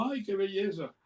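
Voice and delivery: lightly voiced wife admiring the dove; charmed wife. Wife's reply voiced in story. (esposa encantada, voz aguda)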